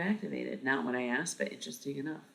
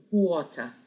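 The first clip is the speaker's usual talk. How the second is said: "Water" louder in a put-on Australian accent; attempted Australian accent; 4 semitones higher, 6 dB louder than usual. Shown as a demonstration. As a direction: try out an Australian accent, a bit higher. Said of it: "if I could do one just on the spot".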